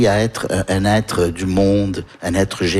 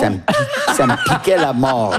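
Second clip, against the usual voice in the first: words squeezed out through laughter, pitch jumping high; laughing; 8 semitones higher than usual. Real, not acted. Remembering his first itchy trousers, laughing hard. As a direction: laugh your way through the complaint.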